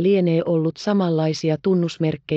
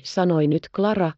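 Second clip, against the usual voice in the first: ordinary delivery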